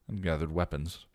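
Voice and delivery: ordinary delivery, nothing marked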